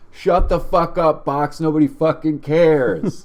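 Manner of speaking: imitating a man yelling back. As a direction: shout down the loudspeaker. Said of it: "guy to my left says".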